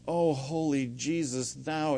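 slow, drawn-out pious prayer; showy mock praying. Mimicking the legalist lady at prayer meeting, mock praying. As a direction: imitate someone praying for show, drawing it out. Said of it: "she was praying all this".

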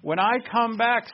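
high, loud proclamation of a promise; Lord Jesus. Acted. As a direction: preach it out as a divine speaker. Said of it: "says the Lord Jesus".

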